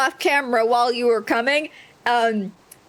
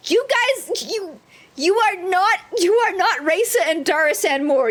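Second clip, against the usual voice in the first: high-pitched, halting accusation; indignant accusation; 5 semitones higher than usual. Acted. Character accuses pair of lying.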